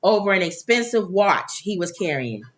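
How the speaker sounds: ordinary delivery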